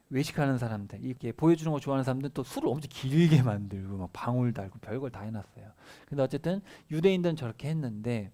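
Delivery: ordinary delivery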